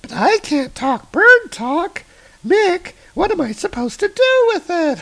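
put-on very high squeaky kid voice. Kids find Baldur talking nonsense, high squeaky kid. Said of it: "It says".

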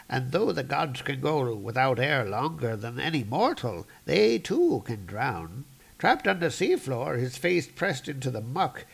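put-on storybook old-timer with swooping intonation; old man narrator. Old man telling of the drowning god, lilting and solemn. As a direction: narrate like an aged teller of legends. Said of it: "the old man narrating here".

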